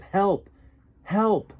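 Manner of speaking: high, loud cries for help. A narrator's reading. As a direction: call out desperately from the burning ship. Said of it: "he heard cries of".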